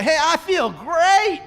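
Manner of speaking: cheerful reply. Retold or imitated. Answering a friend's greeting, mock cheerful. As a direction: answer like everything is wonderful.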